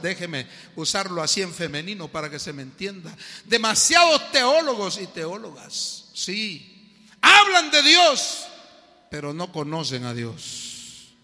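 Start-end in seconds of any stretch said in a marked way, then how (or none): 3.4-8.5 loud, slow, emphatic preaching; preaching at full force. Acted. Preacher driving home the lesson to the congregation, loud and slow. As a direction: hammer the point home to the congregation.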